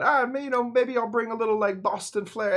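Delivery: high, bright put-on voice for Cleve's idea; voiced inner thought. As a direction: playfully act out his thinking.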